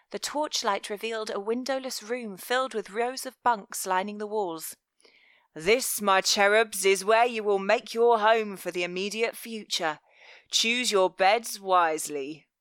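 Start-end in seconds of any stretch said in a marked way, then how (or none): 5.5-12.5 velvet voice. Acted. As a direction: savour each word like a threat. Velvet shows the bunk room.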